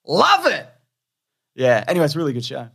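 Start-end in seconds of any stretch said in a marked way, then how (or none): 0.1-0.7 "Love it" jumped way up high in glee; gleeful squeal. Real, not acted. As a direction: squeal "Love it" in delight, much higher. Gleeful repeat after laugh.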